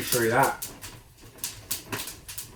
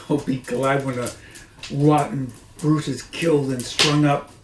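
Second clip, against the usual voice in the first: louder called line; the woman calls up the stairs, louder; calling out; 3 semitones higher, 8 dB louder than usual. Acted. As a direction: call it up the stairs. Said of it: "she called out".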